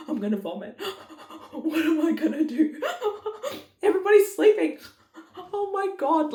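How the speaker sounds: high, gasping mock panic through laughter; hammed-up panic. Mum retelling her fear of vomiting, laughing mock panic. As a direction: squeal it in breathless pretend panic, laughing.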